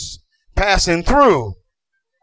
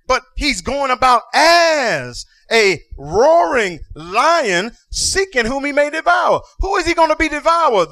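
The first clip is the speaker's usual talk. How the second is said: drawn-out, rising into a shout; preaching build; 6 semitones higher, slower than usual. Acted. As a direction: climb toward the climax.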